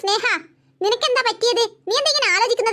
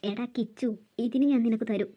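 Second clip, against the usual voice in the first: much lower and softer, a muttered menace; under-breath threat; 11 semitones lower, 6 dB quieter than usual. Acted. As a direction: threaten him quietly behind his back. Scheming aunt threatens Kichu.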